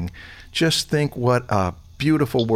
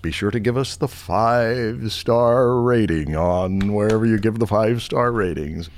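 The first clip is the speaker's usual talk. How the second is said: announcer voice. Acted. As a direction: wrap up the show like a TV announcer. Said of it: "I could do the announcer voice thing".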